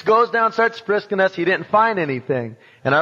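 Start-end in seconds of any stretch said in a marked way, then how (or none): none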